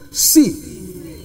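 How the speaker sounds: a hushed "See." before the shout; whispered see. Shown as a demonstration. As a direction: breathe "See." with no voice, just air. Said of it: "Oh, no, no, you didn't hear me".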